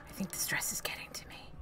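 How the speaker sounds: quick whispered aside; whispering. Real, not acted. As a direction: mutter it fast under breath.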